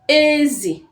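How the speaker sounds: Ezi said with a high first syllable; Igbo word ezi. Shown as a demonstration. Teacher modelling Ezi for the learners, high tone on e. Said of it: "Ezi means pig".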